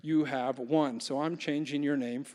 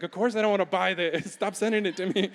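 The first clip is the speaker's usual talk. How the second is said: louder and higher, laughing through it; laughing refusal; 5 semitones higher, 4 dB louder, faster than usual. Retold or imitated. Pastor mock-refusing race photo sales, laughing. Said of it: "I'm like".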